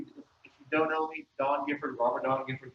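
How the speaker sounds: ordinary talk, nothing marked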